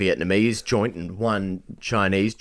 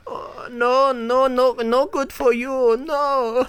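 much higher, slow mock Chinese accent; 14 semitones higher, much slower than usual. Shop worker refusing extra chicken salt, high mock Chinese accent. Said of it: "but I'll do Chinese".

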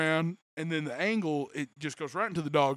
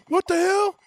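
much higher, louder retold yell; grandpa yelling; 10 dB louder than usual. Grandpa hearing the dog story, loud mock yell. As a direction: blurt it out in disbelief. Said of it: "And he's like".

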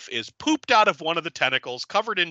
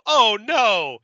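higher, louder hammed-up panic; mock alarm; 7 semitones higher, 6 dB louder than usual. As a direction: gasp it like horror movie victims. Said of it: "And they go".

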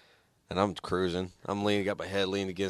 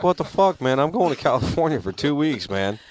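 indignant retort; 7 semitones higher, 4 dB louder than usual. Retold or imitated. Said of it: "I was like".

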